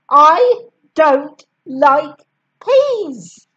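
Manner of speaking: slow, loud and stubborn; stubborn refusal. A narrator's reading. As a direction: refuse flatly and slowly.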